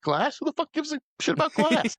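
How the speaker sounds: high, indignant comeback; mock exasperated retort. Podcaster imitating the retort to the police chief, exasperated. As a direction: throw it back in high-pitched disbelief.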